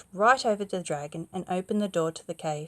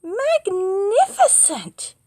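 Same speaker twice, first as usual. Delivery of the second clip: high, slow, drawn-out praise; gushing praise; 13 semitones higher, 10 dB louder, much slower than usual. Acted. Princess egging the dragon on, high and gushing. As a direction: lay the admiration on thick.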